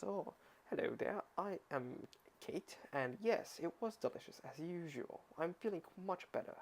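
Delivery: a light, hammed-up British accent; British accent put on by an amateur reader; poor British accent. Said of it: "That's my poor British".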